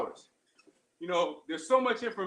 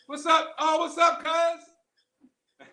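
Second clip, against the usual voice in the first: calling out; 7 semitones higher, 6 dB louder, much slower than usual. Real, not acted. Calling out to a passing friend.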